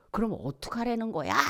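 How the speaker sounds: high-pitched mock frustration; exasperated protest. 답답해 따지는 사람 흉내. (답답해서 따지는 말투)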